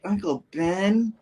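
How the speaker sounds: put-on high, slow little-girl whine; bratty kid voice. Daughter interrupting the adults at dinner, high slow childish whine. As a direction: play the rude daughter, drawn out. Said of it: "She's like".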